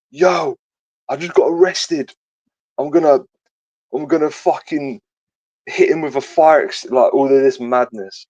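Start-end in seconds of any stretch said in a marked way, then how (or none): 0.1-6.8 high, excited mock of his panicked texts; frantic friend's messages. Retelling the arrested mate's panicked texts, high excited mock. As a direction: voice him higher, hyped up and rattled. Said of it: "He's going".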